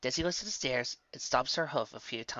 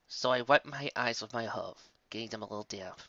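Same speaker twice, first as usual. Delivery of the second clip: plain reading voice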